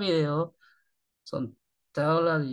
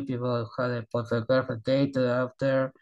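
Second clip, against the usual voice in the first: normal talking voice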